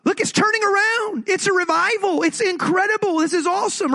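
high, delighted exclamations; can't believe how good it is; God celebrating the revival in a high, thrilled voice; thrilled disbelief. Retold or imitated. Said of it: "It was like".

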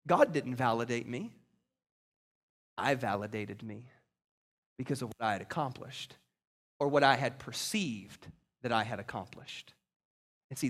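hushed confession. Acted. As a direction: confess it quietly, as if to yourself. Confession brought down to a hush.

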